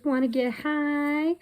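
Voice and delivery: high-pitched cheeky question; playful slogan read-out. Retold or imitated. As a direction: lift it high into a drawn question. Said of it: "It says".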